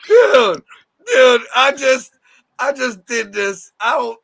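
slow, faltering fake distress; mock tearful confession. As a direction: whimper it to a friend, overdone. Acts out distressed message to friend.

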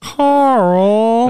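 loud, high, drawn-out call; joking name call. Pretend. Co-host riffing on Karen names, as a drawn-out holler. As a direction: stretch the name out in a mock holler.

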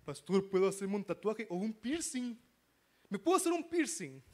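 mock adolescent, pitch rising as they plead; adolescent asking. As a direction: imitate a teenager asking the pastor permission. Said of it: "cuando un adolescente llega y me pregunta".

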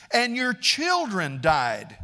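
high, loud, slow accusation; forceful acted condemnation; accusing. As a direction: drive each word home at the accused. Preacher voices Bildad accusing Job.

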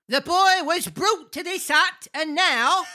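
put-on old man as high-pitched chat-show host; Alan Carr. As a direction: answer in a high comic host impression. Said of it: "Can you do him like Alan Carr?".

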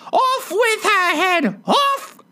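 high-pitched screeching outburst of rage; furious scream. A narrator's reading. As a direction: shriek the command, very high, words stretched out. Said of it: "like a wild beast, screamed".

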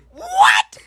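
very high mock-shocked "what"; retold high squawk of shock; outraged disbelief. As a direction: shriek it in comic disbelief. Retold outrage at being offered alcohol.

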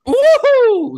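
very high, loud hooting cheer; wild whoop. Real, not acted. Friend cheers at a shocking food take, wild whoop. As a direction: whoop like the crowd just went wild.

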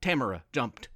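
ordinary delivery, nothing marked

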